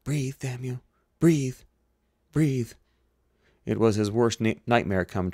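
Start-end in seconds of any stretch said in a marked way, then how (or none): 0.0-2.7 higher-pitched, desperate urging; will the patient back to life; urgent command. Acted. Doctor to patient who is not breathing, urgently.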